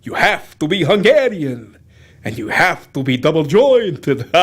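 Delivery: put-on Hungarian-accented vampire star; Bela Lugosi. Explaining you must be Hungarian, vampire-film accent. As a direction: roll it out in a thick Hungarian accent. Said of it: "there was Bela Lugosi".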